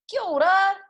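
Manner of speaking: called farewell. A narrator's reading. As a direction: call it across as they leave. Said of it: "the whānau waved".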